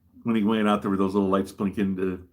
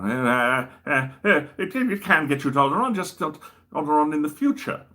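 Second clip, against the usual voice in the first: high, stammering, flustered Doctor voice; stammer, then hurry out the answer up high; Second Doctor; 7 semitones higher, 4 dB louder than usual. Acted. Doctor assures Obi-Wan about Alderaan, in a high stammering Second Doctor voice. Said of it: "The second Doctor met Obi-Wan".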